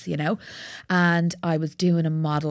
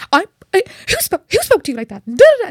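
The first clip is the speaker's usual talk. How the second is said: much higher, louder mock outrage; outraged friend; 14 semitones higher, 13 dB louder than usual. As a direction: play the roommate fuming for her. Friend outraged on her behalf.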